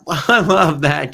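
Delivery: said through a real laugh, slowly. Real, not acted. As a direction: let the laugh carry the words. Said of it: "L O L".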